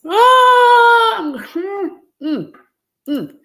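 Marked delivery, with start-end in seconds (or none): 0.1-1.2 high, loud overwhelmed wail; emotional cry. Real, not acted. Mother overwhelmed at seeing her son, crying out. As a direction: let out a choked cry of emotion.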